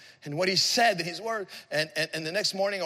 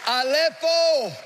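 high, drawn-out proclamation of ALEPHO; exultant cry; 10 semitones higher, 6 dB louder, much slower than usual. Real, not acted. Preacher proclaiming the Greek word for wiped out, exultant cry. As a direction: ring out ALEPHO slowly like a pulpit shout. Climactic cry, then repeated softly.

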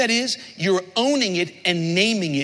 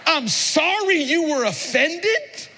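slow, higher, sarcastic sorry; snide non-apology; 7 semitones higher, slower than usual. Shown as a demonstration. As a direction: drag it out with disdain. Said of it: "That's what that means".

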